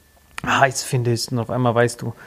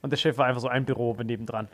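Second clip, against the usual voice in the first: ordinary talk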